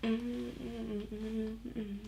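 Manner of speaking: soft mimicked child's mm-mm; wordless whiny hum. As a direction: hum noncommittally like a stalling child. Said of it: "she'll just kinda".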